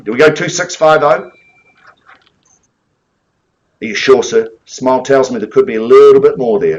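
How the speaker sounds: ordinary delivery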